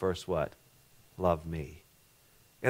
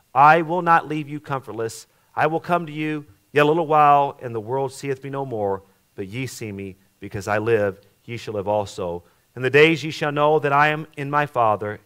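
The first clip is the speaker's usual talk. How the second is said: loud, higher, ringing King James reading; preacher reading scripture at full force; preached scripture reading; 3 semitones higher, 6 dB louder than usual. Acted. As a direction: proclaim the verses to the congregation. Reads John fourteen aloud, preaching.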